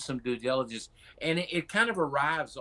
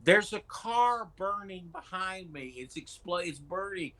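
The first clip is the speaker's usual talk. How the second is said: quoting Glenn Beck; 7 semitones higher than usual. Retold or imitated. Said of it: "I mean, he said".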